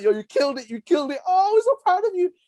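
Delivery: high-pitched imitated gushing praise; gassing him up. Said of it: "everyone's gassing me up".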